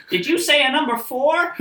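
loud, very high questioning voice; intercom lady. Acted. Drive-thru lady checking the order over the intercom, shrilly mimicked. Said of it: "the lady over the intercom".

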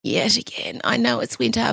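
ordinary talk

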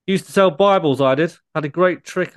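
normal talking voice

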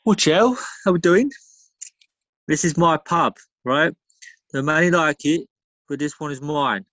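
put-on a light London geezer accent. Pub regular greeting his mates, lightly done London geezer accent. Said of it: "I can kinda do that accent".

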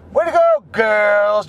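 high mocking "way to go"; mock them with fake praise; sarcastic high congratulations; sarcastic cheer. Real, not acted.

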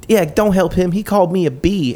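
high, put-on complaining voice; high-pitched mock indignation; indignant old lady. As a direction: imitate an offended woman tattling. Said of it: "And she's like".

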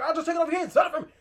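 high, loud mock-desperate offer; frantic haggling. Mayor trying to buy back the Sawyer house, mock frantic. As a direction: blurt out bids like a frantic buyer.

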